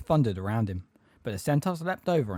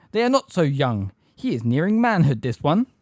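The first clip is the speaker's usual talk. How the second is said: loud, higher-pitched argument; stern objection; 5 semitones higher, 6 dB louder than usual. A narrator's reading. Grey centaur disputing Ronan over Harry, stern and forceful. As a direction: press the case against mercy. Centaur argues against mercy.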